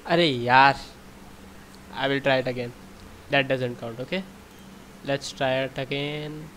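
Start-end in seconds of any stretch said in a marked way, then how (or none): 0.1-0.7 loud exasperated groan; fed up and loud; frustrated. Real, not acted. Frustrated after bad try.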